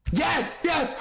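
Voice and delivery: high, thrilled shout of agreement; excited cheering. Pretend. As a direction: cheer them on like a fan.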